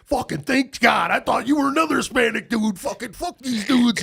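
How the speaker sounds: loud, high mock gamer rant; relieved, then raging, hammed up; losing his mind. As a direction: imitate a teammate's worked-up outburst. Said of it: "just losing their mind".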